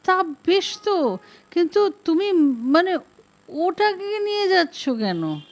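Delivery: a tipsy man suddenly fussing; flustered protest. A narrator's reading. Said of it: "Basu ব্যস্ত হয়ে ওঠেন".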